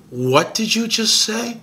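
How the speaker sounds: stunned disbelief. Retold or imitated. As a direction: ask it like you can't believe your ears. Stunned reaction to the translation.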